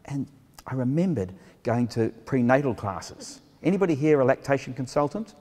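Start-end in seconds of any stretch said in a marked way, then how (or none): none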